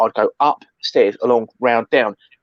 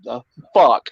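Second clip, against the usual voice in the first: imitating Tim; 4 dB louder, much slower than usual. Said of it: "he looked round with a face like that".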